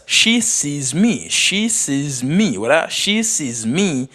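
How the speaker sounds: each word said slowly and distinctly; slow and clear. Shown as a demonstration. Teacher models a practice sentence, slowly and clearly. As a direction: draw out every word for learners. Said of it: "Fòk ou byen enonsye mo yo".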